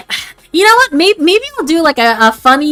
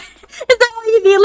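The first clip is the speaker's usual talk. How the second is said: ordinary talk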